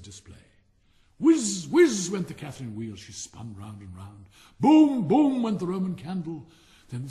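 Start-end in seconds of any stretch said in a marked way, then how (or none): 1.2-1.7 whooshing whiz. A narrator's reading. Firework noise. 4.6-5.4 loud, punchy boom; imitating a roman candle firing; booming firework. A narrator's reading. Firework noise.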